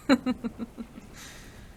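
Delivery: a little knowing laugh, barely voiced. Scientist chuckling to herself about being called a witch, breathy chuckle.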